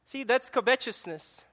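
normal talking voice

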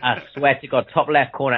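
ordinary talk

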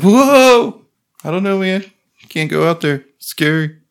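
high, timid voice of a big dog afraid to go out; scared dog voice. Retold or imitated. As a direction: squeak it out like a nervous pit bull. Voicing scared bully dog.

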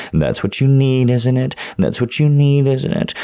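loud, high, excited insistence; heated insistence. Acted. Lover seeks partner's agreement, loud and heated. Heated climax of intimate scene.

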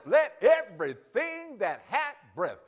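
preacher's rising declaration; emphatic reading. Shown as a demonstration. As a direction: call it out slowly to the whole room. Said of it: "You got breath".

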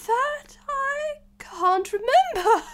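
very high, slow, tearful wail; distraught Hatter. Acted. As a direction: wail it tearfully, stretching each word. Flustered Hatter fails to answer.

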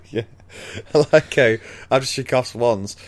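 normal talking voice

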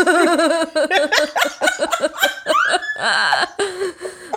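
long high wheezing laugh; high cackle. Real, not acted. Host cracking up at a listener's ice cream joke, long cackle.

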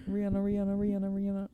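high-pitched laughing chant. Real, not acted. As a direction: laugh through the name. Laughing chant of full name.

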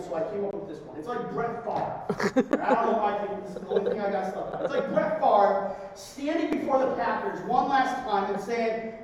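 ordinary talk, nothing marked

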